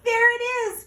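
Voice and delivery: high, loud frightened shout; terrified cry. Acted. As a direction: scream it out in fear. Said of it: "cried Frog".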